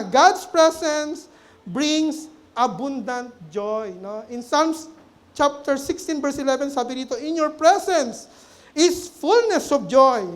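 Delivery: measured pulpit proclamation; preaching. Acted. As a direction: drive each phrase home to the congregation. Preaching the point at full force.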